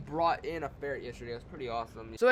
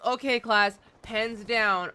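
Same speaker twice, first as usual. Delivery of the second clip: teacher calling out; 6 semitones higher, 6 dB louder, much slower than usual. Retold or imitated. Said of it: "and then she goes".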